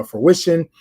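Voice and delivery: ordinary delivery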